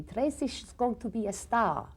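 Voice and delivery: put-on a touch of a Polish father's accent, slow; dad's Polish accent. Dad telling little Tracy she'll be a star, mild Polish accent. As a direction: lightly imitate a Polish dad. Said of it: "He had this accent".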